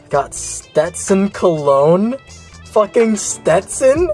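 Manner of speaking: ordinary talk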